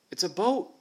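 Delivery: high and loud, a sudden cry of discovery; excited exclamation. Acted. Molly spots the boat on the beach, excited cry. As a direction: blurt it out, thrilled to see the boat. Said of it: "she exclaimed".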